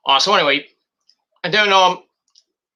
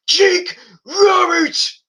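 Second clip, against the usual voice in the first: dramatic entrance call; 14 semitones higher, 4 dB louder, much slower than usual. Acted. Announcing Jake Roberts' entrance.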